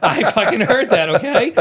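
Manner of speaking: loud defensive laugh-talk; laughing outburst. Real, not acted. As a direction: defend yourself while laughing.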